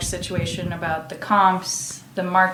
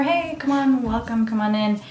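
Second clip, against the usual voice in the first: much higher pitched, hearty greeting; cheerful welcome; 5 semitones higher than usual. Retold or imitated. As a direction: greet the guest brightly at the door. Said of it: "Landon just opened the door".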